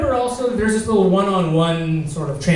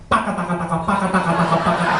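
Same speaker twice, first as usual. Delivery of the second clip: fast babbling nonsense syllables; mock gibberish chatter; 2 semitones lower, much faster than usual. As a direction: rattle off paka taka like foreign chatter. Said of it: "sounding like saying".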